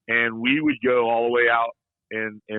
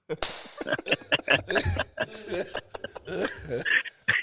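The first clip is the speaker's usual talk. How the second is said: long, high, breathless laugh; helpless laughter; 7 semitones higher, 6 dB quieter than usual. Real, not acted. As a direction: lose it completely in laughter. Cracks up after mock telling-off.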